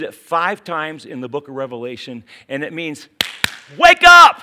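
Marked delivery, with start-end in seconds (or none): 3.8-4.4 sudden full-voice shout, high; a preacher's rousing cry; shouted command. Acted. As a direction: jolt them awake with a yell. Preacher shouting Jesus' warning.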